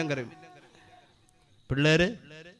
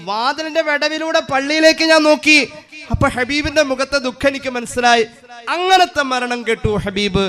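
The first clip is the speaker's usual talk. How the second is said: lightly voiced woman, higher; a hint of Aisha telling what she saw; Aisha's voice; 8 semitones higher than usual. Voicing Aisha retelling.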